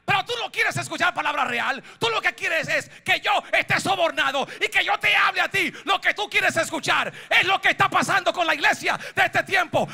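high, driving shout building in volume; full-force preaching. Real, not acted. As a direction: hammer each charge home to the church. Prédica a toda fuerza. (prédica a gritos, encendida)

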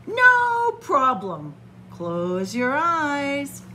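slow, drawn-out show of confidence; slow bravado. Acted. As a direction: pretend to be totally cool.

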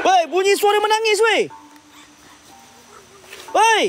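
high, startled shout of alarm; alarmed cry. Real, not acted. Host hears a woman crying, alarmed and high. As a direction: react to hearing crying.

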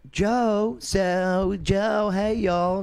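high, lightly Southern lady voice; a touch of Southern belle greeting; Southern drawl. Retold or imitated. Landlady greeting Joe, lightly Southern. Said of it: "Very Southern".

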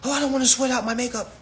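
high, quick fussy protest; mocking girlish complaint; mock girly whine. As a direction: imitate a prissy girl avoiding exercise. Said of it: "too girly".

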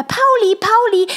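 hoch gesungenes Locken: „Pauli, Pauli!“; Hund locken. A narrator's reading. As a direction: ruf den Hund mit hoher, singender Stimme. Lina ruft den Hund. (Lina lockt den Hund Pauli, hoch und singend)

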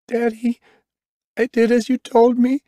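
put-on very high little girl's voice; crying child. A narrator's reading. Daughter to her injured father, high and crying. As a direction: pitch it way up, a tearful daughter. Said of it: "And crying".

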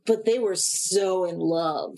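mock-gushy, with a long drawn-out "so"; very slow, swoony emphasis; gushing insistence. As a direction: stretch it out, gushing over the romance. Mimics the episode's gushing insistence.

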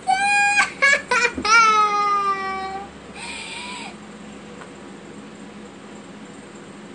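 drawn-out crying wail; loud sobbing wail. Acted. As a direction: wail as you run off crying. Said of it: "She walked away crying".

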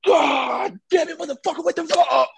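high, strained mock scream; road rage outburst. Host mimicking friend's sudden outburst mid phone call, high-pitched raging scream. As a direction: imitate a friend's furious road-rage shriek, drawn out. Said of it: "next thing you know, he's like".

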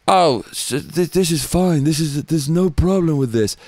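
a light impression of a dithering old man brushing it off; mock Biden. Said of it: "I can imagine Biden".

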